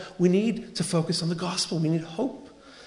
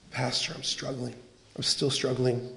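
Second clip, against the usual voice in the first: low, soft voice of a struggler; acted quiet, low plea for help; downcast confession; 5 semitones lower, 8 dB quieter than usual. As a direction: confide it wearily to your pastor, quietly. Voices a struggling man confiding in pastor.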